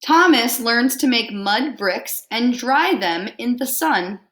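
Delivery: lively picture-book read-aloud; reading to children. Acted. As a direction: read it out warmly to a class.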